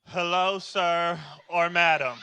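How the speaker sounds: slow, memorized sales greeting; kid reciting script. Acted. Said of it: "They just say what was on that paper".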